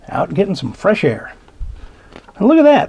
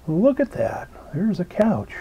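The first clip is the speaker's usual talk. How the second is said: hushed; 4 semitones higher, 7 dB quieter than usual. A narrator's reading. Hushed reveal in picture.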